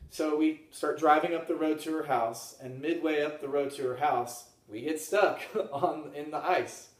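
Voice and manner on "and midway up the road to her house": ordinary talk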